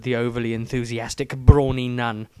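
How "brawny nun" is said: usual speaking voice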